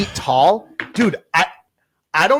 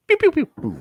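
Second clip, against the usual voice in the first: high zapping pews; laser pew sounds; 13 semitones higher than usual. Pretend. Host joining the laser sound game, high pitched. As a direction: blast off quick laser noises. Rapid fire sound effects.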